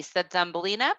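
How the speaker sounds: usual speaking voice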